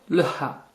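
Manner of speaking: "ha" with a very pronounced exhaled H; strongly breathed H. Shown as a demonstration. Teacher naming the next letter, strongly breathed H. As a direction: push the breath out hard on "ha". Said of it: "un H expiré très prononcé".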